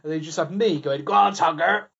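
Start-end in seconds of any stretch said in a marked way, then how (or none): 1.0-1.9 put-on cheering in a Somerset accent; rugby cheer with a Somerset twang. Fan in the stands cheering Tonga, Somerset accent. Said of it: "that's my Somerset accent".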